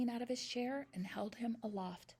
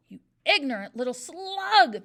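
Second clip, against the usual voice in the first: much louder roaring insult; bellowed; 5 semitones higher, 13 dB louder than usual. A narrator's reading. Headmistress bellowing at a wrong answer. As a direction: bellow it at him. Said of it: "the Trunchbull bellowed".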